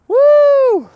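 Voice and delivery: high, loud victory whoop; excited whoop. Real, not acted. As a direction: cheer the split like a win. Cheer after splitting log.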